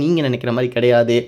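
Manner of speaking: ordinary delivery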